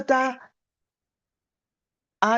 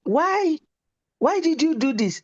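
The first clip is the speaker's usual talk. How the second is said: much higher, alarmed cry of disbelief; shocked mother; 9 semitones higher than usual. Retold or imitated. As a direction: gasp it out in horror at the child. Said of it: "I said".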